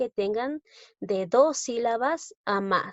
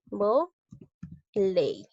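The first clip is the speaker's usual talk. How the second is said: "Voley" split in two with a long pause between; syllable by syllable. Shown as a demonstration. Teacher counting out two syllables for the class, syllable by syllable. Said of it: "tiene dos sílabas".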